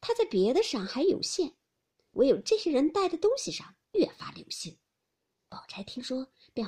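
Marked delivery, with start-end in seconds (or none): 0.0-4.8 higher-pitched, mildly scornful jab; a touch of icy sarcasm; cold sneer. A narrator's reading. Daiyu needles her cousin about noticing trinkets, cold sneer. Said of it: "林黛玉冷笑道".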